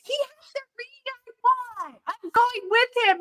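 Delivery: high, eager insistence; giddy and determined. Retold or imitated. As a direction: chase the shiny ring with glee. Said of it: "So I'm like".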